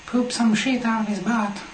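high squeaky dog voice. Retold or imitated. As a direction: slip back into the squeaky dog. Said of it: "That was the voice that he would use".

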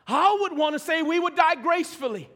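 high, loud preacher's delivery. Real, not acted. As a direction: lift it up over the congregation.

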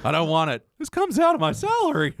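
very high squeaky voice; squeaky teen voice. Retold or imitated. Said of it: "the squeaky voiced teen".

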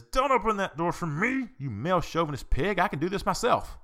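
high, loud indignant shout at the man; angry retort. Retold or imitated. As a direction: bite his head off for holding the door. Angry lady snaps at door-holder.

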